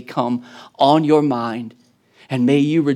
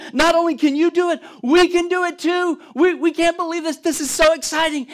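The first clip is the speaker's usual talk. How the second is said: much higher, fast, giddy chatter; giddy disbelief; 13 semitones higher than usual. Acted. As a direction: gush like someone who can't believe their luck. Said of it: "they're excited, they can't believe".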